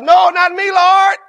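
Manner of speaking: high, loud protesting shout; Peter's yell. Acted. Peter refusing Jesus washing his feet, loud high shout. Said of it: "And Peter yells out".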